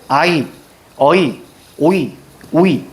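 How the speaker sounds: put-on "아이, 어이, 오이, 오이" as old diphthongs, one by one. Shown as a demonstration. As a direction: read "아이, 어이, 오이, 오이" slowly, each as two vowels gliding into i. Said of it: "옛날에 어떻게 읽었다고". ('아이, 어이, 오이, 오이'를 두 모음이 이어지게 천천히)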